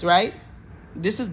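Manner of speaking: ordinary talk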